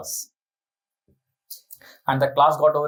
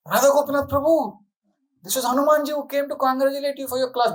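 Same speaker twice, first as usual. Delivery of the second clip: higher, eager declaration of a miracle; excited announcement; 8 semitones higher than usual. Retold or imitated. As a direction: announce it like a revelation. Excitedly telling senior devotee.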